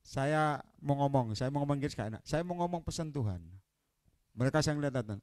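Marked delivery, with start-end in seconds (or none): none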